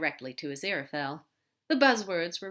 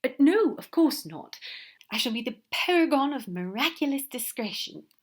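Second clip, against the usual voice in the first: nervous giggle; 7 semitones higher, 6 dB louder than usual. A narrator's reading. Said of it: "with a nervous giggle".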